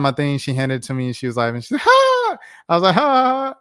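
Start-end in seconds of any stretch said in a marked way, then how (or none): 1.8-2.4 loud, very high mimicked laugh; one piercing high "ha" imitating her laugh; Zendaya. Actress laughing as she hands back the notebook, high mock laugh. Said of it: "what the heck she was laughing at". 2.9-3.5 loud, high put-on laughing along; fake laugh. Fan laughing along without knowing why, loud high fake laugh. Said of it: "what the heck she was laughing at".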